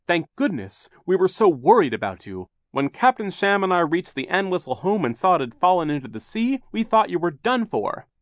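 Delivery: high, loud, worried guardian; Mister Poe, relieved. A narrator's reading. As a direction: gush with relief after a fright. Mister Poe greets rescued children.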